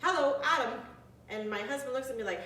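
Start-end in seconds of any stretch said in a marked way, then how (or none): 0.0-0.8 loud, high squawky parrot greeting. Retold or imitated. Retelling the garage parrot greeting Adam, loud high squawk. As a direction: mimic the shop parrot calling out a name. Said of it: "the bird goes".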